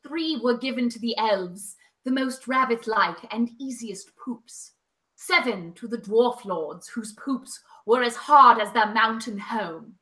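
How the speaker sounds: slow, low, solemn elven narration; Galadriel as Cate Blanchett. Acted. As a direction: intone it like a grave ancient prophecy, slowly. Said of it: "do this in my Cate Blanchett Galadriel voice".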